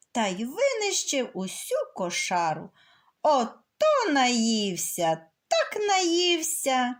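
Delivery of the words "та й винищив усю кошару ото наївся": ordinary delivery, nothing marked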